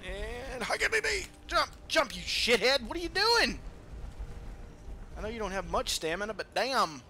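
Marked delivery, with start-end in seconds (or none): none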